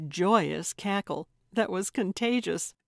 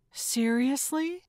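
high, slow, rising disbelief; incredulous; 7 semitones higher than usual. A narrator's reading. Carlotta reacts to the hammer request, incredulous. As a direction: question it in drawn-out surprise.

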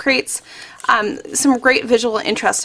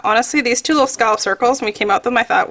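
usual speaking voice

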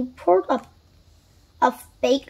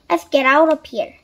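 louder, lightly called-out line; calling out; 7 dB louder than usual. A narrator's reading. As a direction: call it out with some force. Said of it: "he called".

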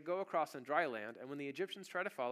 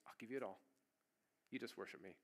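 softer, low seductive devil, rushing the last line; tempting devil; 4 semitones lower, 9 dB quieter, faster than usual. Acted. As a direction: lure him in quietly, hurrying the ending.

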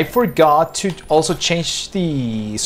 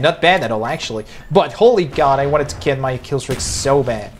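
ordinary talk

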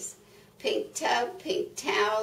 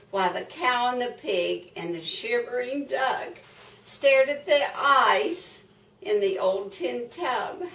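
plain reading voice

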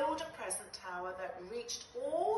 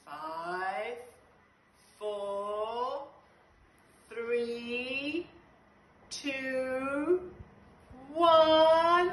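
building countdown; 3 semitones higher, 10 dB louder, much slower than usual. Acted. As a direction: build the count to a big yell. Countdown to blast off.

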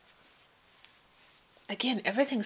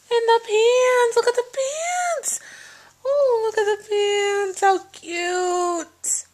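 very high, excited squeal over cute clothes; squealing excitement; 14 semitones higher, 4 dB louder, slower than usual. Real, not acted. Mom gushing over baby pants, high excited squeal. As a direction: gush in a high delighted squeal.

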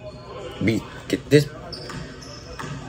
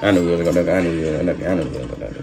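fading mutter; 7 semitones lower, 7 dB quieter, faster than usual. Real, not acted. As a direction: repeat it quieter and quieter till it is a mumble. Repeats the line, trailing away.

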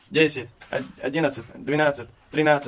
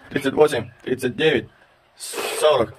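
ordinary talk

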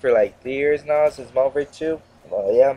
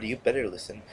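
quiet warning; 2 semitones lower, 8 dB quieter, faster than usual. Acted. Gamer repeating the warning to Infinity Ward, softly. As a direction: say it under your breath, menacing.

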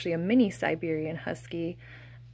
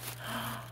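soft gasp of surprise; breathy intake of delight; excited gasp; 13 dB quieter than usual. Real, not acted. Sees the second plush toy.

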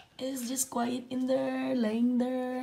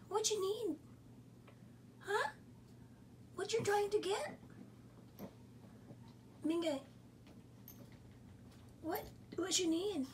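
much higher sing-song pet voice; 7 semitones higher, 9 dB quieter than usual. Real, not acted. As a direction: coo at the dog. Baby-talk to pet.

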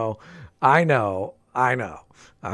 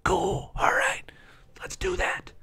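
pleading whisper; 8 semitones higher, 7 dB quieter than usual. Pretend. Pleading from jail in the game.

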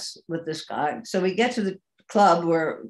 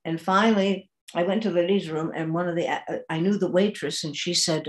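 ordinary talk

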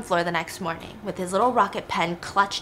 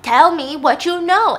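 louder, higher acted order; angry demand; 6 semitones higher, 7 dB louder than usual. Dad demands answers from daughter, acted angry insistence. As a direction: press the demand with a raised voice. Father repeats his demand.

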